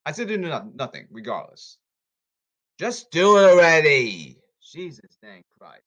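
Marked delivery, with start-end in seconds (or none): 2.8-4.4 slow, loud insistence; loud slogan. Real, not acted. 4.6-5.8 exasperated mutter. Real, not acted. Muttered exasperation after shout.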